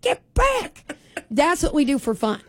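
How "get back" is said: high, sharp call to the dogs; yelling at dogs. Retold or imitated. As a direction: holler at dogs across the yard. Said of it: "we yell at the dogs".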